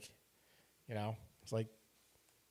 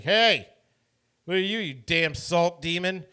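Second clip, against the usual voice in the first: loud, higher jeering call; mock insult; 5 semitones higher, 9 dB louder than usual. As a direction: taunt a stranger from across the street. Imagined stranger insulting him.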